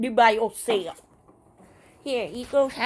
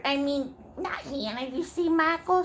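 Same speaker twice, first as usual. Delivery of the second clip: ordinary talk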